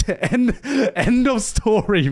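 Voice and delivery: words broken up by laughter; laughing. Real, not acted. Ending the school gang fight story, laughing. Laughing at his own anticlimax.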